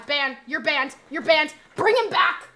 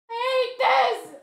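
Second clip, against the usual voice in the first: high, slow drawn-out whine; loud exasperated whine; whiny outburst; 9 semitones higher, 6 dB louder, much slower than usual. Real, not acted. Whining about the guessing game.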